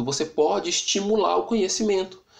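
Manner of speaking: normal talking voice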